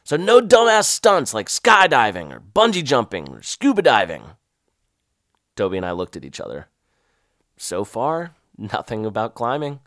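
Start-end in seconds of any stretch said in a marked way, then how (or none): 0.0-4.2 loud barking drill sergeant; gruff sergeant. Retold or imitated. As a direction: bark orders at the recruits. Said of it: "the fat sergeant barked at us".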